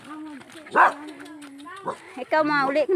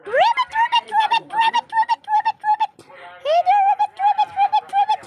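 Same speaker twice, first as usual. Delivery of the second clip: very high squeaky frog ribbits; 4 dB louder than usual. Pretend. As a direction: chirp ribbits like a cartoon frog. Voicing a frog character.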